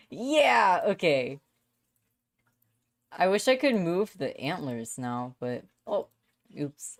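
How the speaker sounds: ordinary talk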